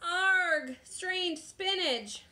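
high, slow, drawn-out wail; pirate wail. A narrator's reading. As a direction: wail it in comic dismay. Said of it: "wailed the pirates".

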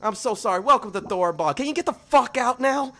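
high, loud strained goblin welcome; manic goblin. Acted. Goblin forcing a welcome then yelling at visitors. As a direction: shout a frantic welcome then throw them out.

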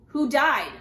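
loud, harsh officer demand; officer harshly questions the mourners, loud. A narrator's reading. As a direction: snap the question like an interrogator. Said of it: "he asked harshly".

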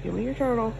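ordinary talk

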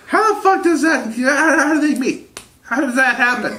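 high, loud, disbelieving outburst; how could those two even meet; incredulous. Real, not acted. Friend hears the odd matchup, loud high disbelief.

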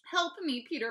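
high, loud, urgent plea; begging. A narrator's reading. Mom needs Peter's help with Fudge, begging. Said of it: "Mom begged".

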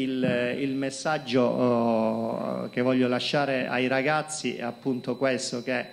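ordinary talk

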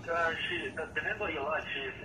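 ordinary delivery

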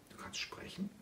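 breathless, amazed whisper; astonished whisper. Acted. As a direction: whisper it in disbelief. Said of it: "fragte Mafalda verblüfft". (verblüfftes Flüstern)

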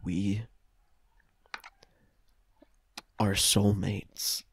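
low, soft, slow soulmate line; mock tender. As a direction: breathe it like a cheesy romance. Dramatic romantic reveal.

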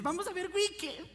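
high-pitched excited boy impression; eager young cousin. Cousin Joaquincito asked what he wants to do, eager high voice.

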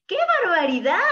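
loud, high, tickled storybook bear; laughing mock astonishment; amused exclamation. A narrator's reading.

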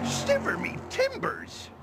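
high, startled pirate cry; pirate exclamation. Acted. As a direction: blurt it out like an old sea dog.

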